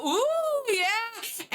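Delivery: high impressed ooh then yeah; crowd gasp and cheer. Acted. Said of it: "people kinda gasp".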